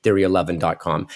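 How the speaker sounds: usual speaking voice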